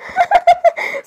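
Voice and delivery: shrill burst of giggling; squeaky high laugh; high-pitched real laugh; high giggle. Real, not acted.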